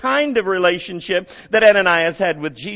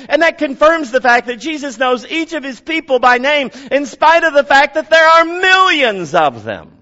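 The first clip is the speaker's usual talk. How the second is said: louder, higher, driving sermon voice; fervent, raised pulpit delivery; preaching at full force; 9 semitones higher, 7 dB louder than usual. Real, not acted. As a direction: preach it out to the back row.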